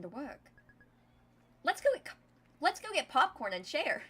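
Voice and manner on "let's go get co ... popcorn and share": much higher and louder, bursting with fake cheer; eager distraction. Min changing the subject with Dia, loud eager cheer. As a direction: sell the popcorn idea way too eagerly.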